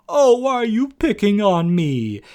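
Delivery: a mocking "why me" plea; mock poor-me whine. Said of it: "kind of gimmick".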